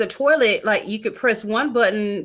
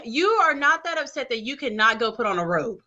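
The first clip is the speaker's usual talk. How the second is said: normal talking voice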